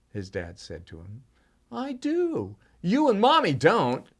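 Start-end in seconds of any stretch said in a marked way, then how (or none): 1.7-4.1 put-on indignant child. Boy argues with dad.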